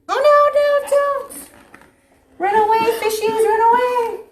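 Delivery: high, alarmed cry to the fish; high playful panic, run away; mock alarm. Warning fish from shark.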